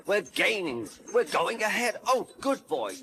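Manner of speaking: high, excited cheering; excited shout. A narrator's reading. Driver cheers on Percy as they gain, high and excited. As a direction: urge him on in a high voice. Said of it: "shouted the driver".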